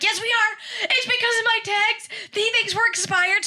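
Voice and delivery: high, frantic panic; panicked high voice to mom. Retold or imitated. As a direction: squeal it out in alarm. Panicking to mom about cop.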